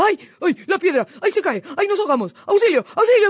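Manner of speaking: high, panicked short cries; frightened yelling. A narrator's reading. Fox wakes fearing the stone falls, scared shouting. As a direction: cry out as if disaster is striking. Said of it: "se puso a gritar asustado".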